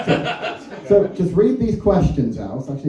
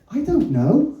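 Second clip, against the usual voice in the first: higher, hesitant little reply; unsure reply; 7 semitones higher, slower than usual. Retold or imitated. To a rough lover, a meek unsure answer. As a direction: sound lost and unsure. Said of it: "I just went".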